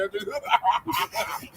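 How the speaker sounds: soft amused chuckle; mocking laugh. Real, not acted. Laughing at scared callers, mocking chuckle. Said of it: "I'm laughing at them".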